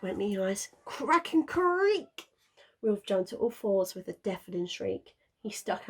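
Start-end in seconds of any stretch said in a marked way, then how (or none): 0.8-2.3 high, slow drawn-out creak; creaking ice. A narrator's reading. Narrator sounding the ice cracking beneath Wilf, slow. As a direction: stretch it out like ice giving way.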